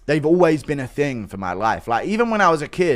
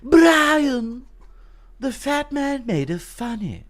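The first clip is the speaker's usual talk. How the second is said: high-pitched cartoon character voice; put-on high cartoon voice; Family Guy impression; 11 semitones higher, much slower than usual. Shown as a demonstration. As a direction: call the name long and high, then comment. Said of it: "What's your best Family Guy impression?".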